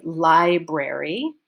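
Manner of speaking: library slow, first syllable li- stressed. Shown as a demonstration. Teacher shows students where library is stressed, slow stress on li-. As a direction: lean on li- in library, slowly. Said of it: "The stress is on li".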